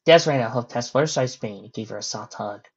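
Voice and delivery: plain reading voice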